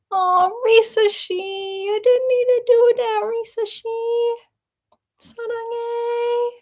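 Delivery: squeaky cute voice thanking Risa Shi; cutesy thanks. Acted. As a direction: coo at her like a gift made your day. Thanking a viewer for a gift.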